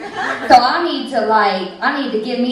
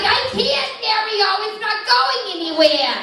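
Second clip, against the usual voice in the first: 1 whiny complaint; 7 semitones higher than usual. Retold or imitated. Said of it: "I'm like".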